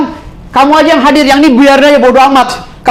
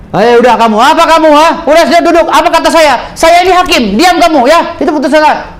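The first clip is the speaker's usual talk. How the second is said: higher, scolding mock judge; 5 semitones higher than usual. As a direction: ham up a rude, bossy judge. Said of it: "Hakim juga kalau ngomong udah kayak orang kagak sekolah". (menirukan hakim yang kasar dan membentak)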